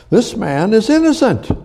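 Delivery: high, pressed declaration of innocence; Pilate declaring. Retold or imitated. Pilate tells the crowd Jesus is innocent, high insistent voice. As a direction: lift it high as Pilate pleads. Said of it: "What did Pontius Pilate say?".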